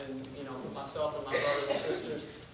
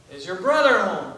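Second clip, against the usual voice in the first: loud, exasperated phone question; getting frustrated; 9 dB louder than usual. Acted. Caller tries for the brother, getting frustrated. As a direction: push the question out louder, losing patience. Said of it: "The man's getting frustrated".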